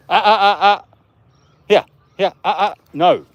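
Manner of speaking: loud, high, sharp scolding; cranky voice. Real, not acted. Owner scolding his dog, loud cranky voice. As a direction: bark a cranky warning at the dog. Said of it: "That's my cranky voice".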